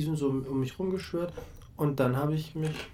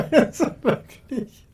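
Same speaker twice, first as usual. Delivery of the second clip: laughing hard while speaking; loud laughter; 11 semitones higher, 11 dB louder, slower than usual. Real, not acted. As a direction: crack up loudly. Bursts out laughing at intro.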